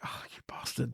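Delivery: a hushed, breathy mock curse; mock whisper. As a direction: whisper the insult like a joke. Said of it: "I was like".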